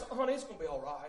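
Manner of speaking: put-on mother comforting her crying child, tenderly; soothing mama. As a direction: hush a hurt child like a mama. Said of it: "some healing in Mama's voice".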